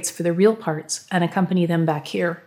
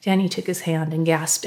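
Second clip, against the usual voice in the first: ordinary delivery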